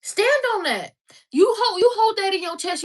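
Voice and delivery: loud, high rallying command; forceful exhortation. Real, not acted. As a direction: drive it home with your chest. Exhorting listeners to stand firm.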